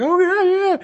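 high-pitched excited squeal of yeah; mock giddy freak-out, voice shooting way up. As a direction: reenact a kid losing it with excitement. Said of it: "freaking out".

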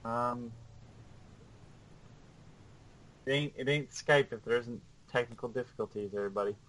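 distant, off-mic voice; far from mic. Real, not acted. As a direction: speak from across the room. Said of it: "sounds like you're far away from your microphone".

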